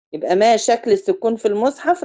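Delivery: ordinary talk, nothing marked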